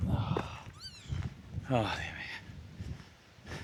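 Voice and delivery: breathless, near-silent groan, dragged out very slowly; spent, whispered sigh of words; exhausted groan. Real, not acted. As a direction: let the tiredness sink out of you. Exhausted after long walk.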